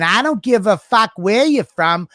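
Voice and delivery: slow, tough Long Island broad voice; mock Long Island accent. Imitating Tina's Long Island rant at hecklers, slow tough accent. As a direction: snarl it like a brash Long Island woman. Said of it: "But she talks like fucking".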